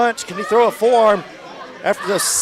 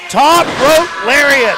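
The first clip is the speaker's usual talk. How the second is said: higher, louder, slowly drawn-out call; excited announcer call; 5 semitones higher, 4 dB louder, much slower than usual. Acted. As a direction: stretch it out like a ring announcer. Calls the move as it hits.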